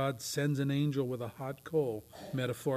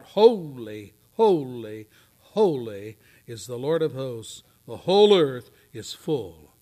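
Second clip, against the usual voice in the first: loud, ringing proclamation; seraph's cry; 10 dB louder, slower than usual. Acted. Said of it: "one of those cried out".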